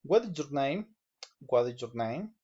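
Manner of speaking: ordinary talk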